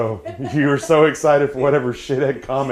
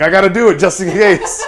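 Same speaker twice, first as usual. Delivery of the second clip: lightly voiced eager chef, laughing; eager cook voice; 8 semitones higher, 8 dB louder than usual. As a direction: play the keen cook. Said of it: "he's like".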